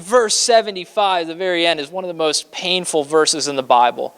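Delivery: ordinary talk, nothing marked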